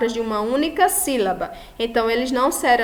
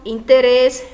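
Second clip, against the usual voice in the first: "interesse" said as Spanish interés, last syllable RÉS louder; final-syllable stress; 6 dB louder than usual. Shown as a demonstration. Teacher giving an example of an aguda, "interesse" as interés with loud final stress. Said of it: "A última sílaba é mais forte".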